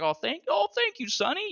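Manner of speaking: put-on high, quavering little old lady voice; exaggerated old lady. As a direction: ham up a sweet granny thanking him. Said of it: "She didn't sound like that".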